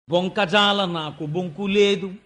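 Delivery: chanted verse, drawn out in a singing lilt; poem recitation. Acted. Storyteller opening the Vamana episode, chanting the verse. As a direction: intone the padyam like a temple reciter.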